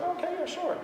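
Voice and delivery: high-pitched, light, easy agreement; nonchalant guests. Retold or imitated. Coach imitates people casually agreeing to a party, nonchalant. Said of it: "It was so nonchalant".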